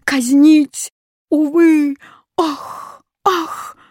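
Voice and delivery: high-pitched wail; hammed-up despairing shriek; sobbing. A narrator's reading. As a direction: cry out loudly, then high gasping moans. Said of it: "зарыдал восклицательный".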